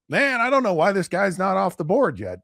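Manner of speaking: sports analyst on TV wondering why a player is still available; Mel Kiper impression. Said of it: "listen to Mel Kiper go".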